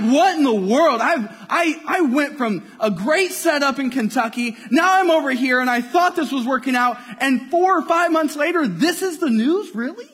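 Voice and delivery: high, loud, incredulous complaint; frustrated outburst. Retold or imitated. Youth minister retelling his reaction to the leadership's news, frustrated outburst. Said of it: "And so I'm frustrated at".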